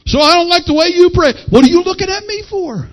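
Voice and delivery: high-pitched challenge from the pulpit; forceful preaching. Real, not acted. Preacher calling out the congregation's prayer life, high and challenging. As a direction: preach it high, confronting the listeners.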